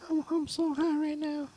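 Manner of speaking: high, squeaky stoned voice; stoner. Pretend. Answers the Towelie joke.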